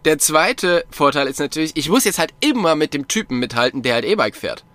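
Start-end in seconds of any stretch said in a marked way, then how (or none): none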